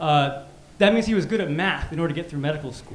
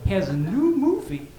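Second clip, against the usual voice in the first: slow, rising Taiwanese dad voice; imitating dad; 10 semitones higher, much slower than usual. Dad on the phone asking about Zach, slow. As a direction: drag it out, baffled immigrant father. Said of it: "he's like".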